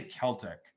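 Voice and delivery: "Celtic" as KEL-tik; hard K opening on "Celtic"; hard-K pronunciation. Shown as a demonstration. Seller stating her own way, "Celtic" with a K. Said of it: "I say Celtic".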